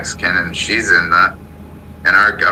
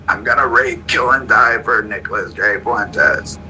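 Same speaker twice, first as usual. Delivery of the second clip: higher-pitched mock fanatic chant; mock insane devotion, pitched up; imitating fanatical followers; 6 semitones higher than usual. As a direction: quote the zealots in a raised, put-on voice. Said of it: "running around acting totally insane".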